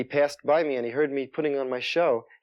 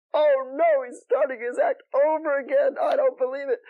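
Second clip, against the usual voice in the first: much higher, louder mock groan of a fan; amazed audience member; 13 semitones higher, 3 dB louder than usual. Audience catching on to the restarted act, gleefully disbelieving. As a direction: squeal it like a delighted spectator. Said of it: "They would go".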